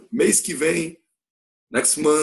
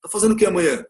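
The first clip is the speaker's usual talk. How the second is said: higher, offhand friendly question; slangy buddy voice; casual slang; 5 semitones higher, faster than usual. Shown as a demonstration. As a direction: ask it like chatting with a friend. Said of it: "tá igualzinho o que eles tão fazendo".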